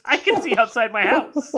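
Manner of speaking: loud, laughing repeat. Retold or imitated. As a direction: crack up while repeating the line.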